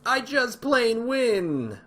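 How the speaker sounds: slow, loud, high gloating I just plain win; gleeful exclaim. Pretend. Streamer gloating to viewers about the combo, loud and drawn out. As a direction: crow it like a cartoon victor. Gloating repeat of own line.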